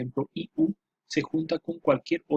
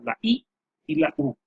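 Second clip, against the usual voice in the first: "la I" and "la U" lifted much higher in pitch; stressing the vowels; 11 semitones higher than usual. Shown as a demonstration. Teacher repeating the closed vowels for students, high emphatic pitch. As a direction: ring out "I" and "U" high to make them stick. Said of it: "vuelvo a repetir".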